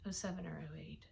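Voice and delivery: whispered correction of "Oh seven or oh eight"; whispering. Real, not acted. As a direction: breathe "Oh seven or oh eight" as an unvoiced aside. Correcting year, whispered aside.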